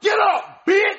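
put-on a kid screeching an insult; bratty yell. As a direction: scream it down at the man you just hit. Kid yells after hitting dad.

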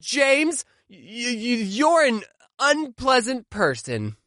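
halting, slow polite insult; hesitant mock insult. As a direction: stammer out a gentle insult. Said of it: "she would insult me back and say".

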